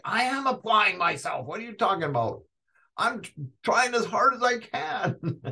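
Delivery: high-pitched exasperated comeback; hammed-up defensive student, voice jumping up; indignant protest. Answering teachers who say apply yourself, high mock indignation. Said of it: "I'd be like".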